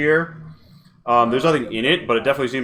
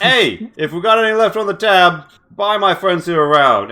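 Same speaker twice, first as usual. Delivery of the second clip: louder, higher British rogue call; hearty call; 7 semitones higher, 5 dB louder than usual. Acted. Hatter ordering a round for the party, hearty British call. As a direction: boom it out to the bar like a generous drunk. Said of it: "he claps you on the shoulder".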